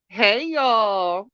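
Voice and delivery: ordinary talk, nothing marked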